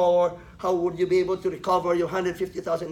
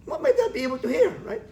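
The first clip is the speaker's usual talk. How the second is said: incredulous question; 13 semitones higher, faster than usual. Real, not acted. Mocking stethoscope over fatty tissue.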